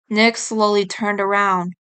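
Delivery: ordinary delivery, nothing marked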